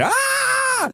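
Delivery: high startled shriek; startled yelp. Retold or imitated. Yelps when room service walks in, high shriek. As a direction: yelp caught off guard. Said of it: "room service came in".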